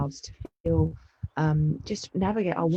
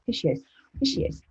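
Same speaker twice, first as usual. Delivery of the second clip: talking to the dog; faster than usual. Real, not acted. Greeting the dog as it arrives.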